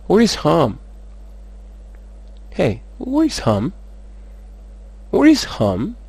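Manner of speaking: "Where is home?" pitched high with swooping tune; varied question intonation. Shown as a demonstration. Teacher shows intonation can vary on "Where is home?", high and swooping. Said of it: "afaka miovaova ny intonation".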